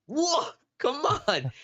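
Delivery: high, slow, exasperated "come on"; mock impatient plea. Waiting to turn in, impatient with a driver, high drawn-out plea. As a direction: draw it out high like losing patience.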